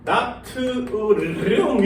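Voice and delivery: 나트륨 drawn out with a rolled r; showy foreign-style 나트륨, syllable by syllable; exaggerated foreign reading. Shown as a demonstration. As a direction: stretch 나트륨 slowly, rolling the r. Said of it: "이렇게 읽지".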